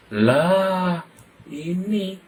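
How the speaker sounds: a touch of a surprised mother; mother finding it. Acted. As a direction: exclaim like a mother spotting the stinger. Mother finds the stinger.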